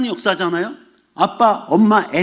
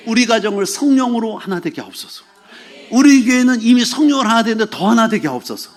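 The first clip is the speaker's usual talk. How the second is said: prayer building louder and higher; fervent prayer; 4 semitones higher, 4 dB louder than usual. Real, not acted. Pastor praying for families and church unity, fervent prayer. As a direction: lift each petition with growing force.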